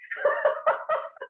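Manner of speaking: a short high laugh that falls away; real laugh. Real, not acted. Laughing at herself about ignoring the sore wrist. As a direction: laugh at your own stubbornness.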